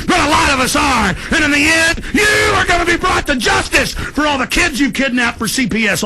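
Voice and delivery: high, shouting angry tirade; furious rant. Real, not acted. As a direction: rage at them, building. Escalating rant at the system.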